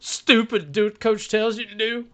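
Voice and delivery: high-pitched mimicked movie coach; Waterboy coach impression. Quoting Waterboy's coach to fellow hosts, high mimicking voice. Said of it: "the coach".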